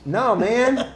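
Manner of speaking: high, drawn-out objection; exasperated retold protest. Client protesting to the tattooer, exasperated. Retold argument over color.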